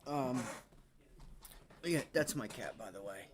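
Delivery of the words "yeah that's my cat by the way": ordinary talk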